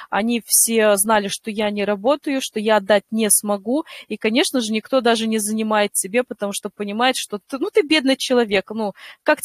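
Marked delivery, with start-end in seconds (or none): none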